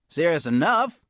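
a tough iguana cook sounding the alarm; high anxious complaint about food thieves; worried cafeteria lady. Acted. Missus Bagoong answers.

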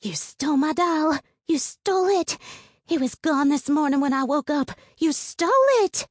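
high, loud furious accusation; angry girl screaming accusations. A narrator's reading. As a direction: shriek it at the thief. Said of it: "what Mary Polly is screaming".

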